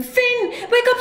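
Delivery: high urgent call; cried out. Acted. Una cries out to wake Finn. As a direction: shake him awake with your voice. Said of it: "she cried".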